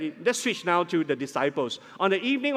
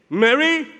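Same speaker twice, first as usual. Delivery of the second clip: name called loud and high; demonstrated forceful call; sharp urgent call; 7 semitones higher, 8 dB louder than usual. Shown as a demonstration. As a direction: call out to break through someone's crying. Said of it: "was it a gentler tone?".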